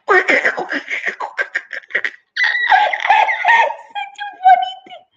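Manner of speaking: high, squealing monkey cackle; shrieky high giggling creature; laughing monkey. A narrator's reading. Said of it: "the monkey is laughing at him".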